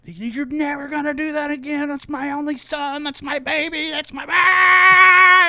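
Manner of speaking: high mock sister voice, ending in a loud cry; protective sister. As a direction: whine like a mother guarding her baby, then shriek. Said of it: "she was just like".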